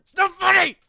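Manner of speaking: loud, high, laughing protest; anger turning to laughter. Real, not acted. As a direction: yell at them to stop while cracking up. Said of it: "Don't laugh. Stop laughing".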